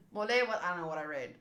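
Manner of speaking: ordinary delivery, nothing marked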